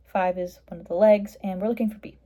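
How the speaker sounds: ordinary talk, nothing marked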